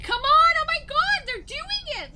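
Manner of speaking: very high, thrilled urging; excited. Retold or imitated. Rallying others to join the parents' bridge, excited. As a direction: urge them on in a high, giddy rush. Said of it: "Why are you so excited?".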